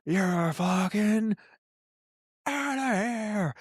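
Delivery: slow, high, wailing "outta here" with a crack in the voice; milk it like a ham umpire tossing a player; umpire ejection call. Pretend. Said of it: "You're like".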